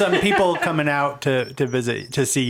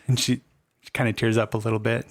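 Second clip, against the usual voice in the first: usual speaking voice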